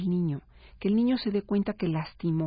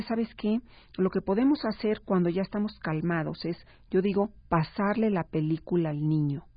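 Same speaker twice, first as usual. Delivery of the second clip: usual speaking voice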